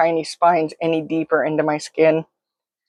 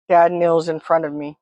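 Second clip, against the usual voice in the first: usual speaking voice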